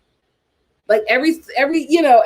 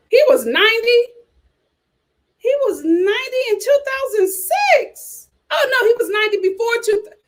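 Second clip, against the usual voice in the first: high, astonished, slowing on the year; high and stunned, then rushing a correction; shocked disbelief; 11 semitones higher than usual. Real, not acted. Shocked at husband's age.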